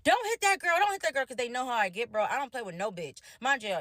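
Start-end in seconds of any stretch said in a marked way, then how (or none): 0.1-1.2 urgent, high retold warning; imitated screaming. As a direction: imitate a friend frantically yelling down the phone. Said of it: "screaming in my ear".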